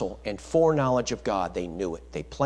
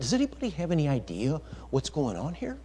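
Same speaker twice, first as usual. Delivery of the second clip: mock casual Peter; 3 semitones higher, 5 dB quieter than usual. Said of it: "He didn't say that".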